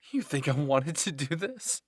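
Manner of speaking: loud, heated retort; snapping. A narrator's reading. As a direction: snap back loudly at the accusers. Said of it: "Berthold snapped".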